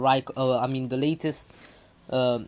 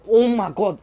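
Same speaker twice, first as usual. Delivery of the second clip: higher, louder burst of exasperation; exasperated; 6 semitones higher, 7 dB louder than usual. Real, not acted. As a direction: burst out at your own slip. Exasperated at own repeated slip.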